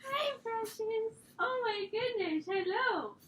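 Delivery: high cooing pet voice. Real, not acted. Cooing at the dog on camera, baby talk. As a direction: coo at the puppy.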